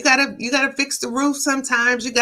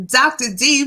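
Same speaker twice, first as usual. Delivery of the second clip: ordinary talk, nothing marked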